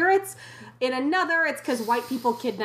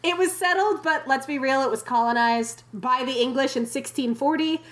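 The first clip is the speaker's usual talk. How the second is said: normal talking voice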